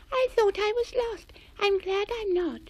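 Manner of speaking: tiny mouse squeaking hello; squeaky voice. A narrator's reading. Millicent Mouse speaks.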